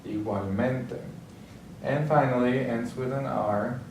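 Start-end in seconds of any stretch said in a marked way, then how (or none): 0.1-1.0 "igualmente" stressed on men; stress on second-to-last syllable. Shown as a demonstration. Teacher models vowel-ending stress for learners, "igualmente" stressed on men. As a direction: put the main weight on "men" in "igualmente". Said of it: "stress is going to be on the second to last".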